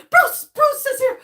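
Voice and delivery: high, loud joyful announcement; excited shout. Real, not acted. Streamer announcing to chat that Bruce arrived, thrilled. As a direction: shout the news with glee.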